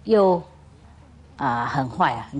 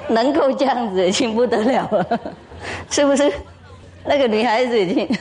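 laughing while talking; 5 semitones higher than usual. Real, not acted. Laughing at her own joke.

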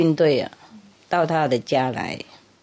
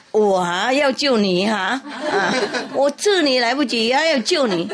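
higher, laughing through the cat's reply; 6 semitones higher than usual. Acted. Cat mocks the drowning mouse, laughing. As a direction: scoff at the mouse while giggling.

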